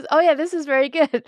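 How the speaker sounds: lightly voiced, gleeful approval; mock delighted voice. Retelling the match, a hammed-up pleased voice. Quoting someone enjoying it.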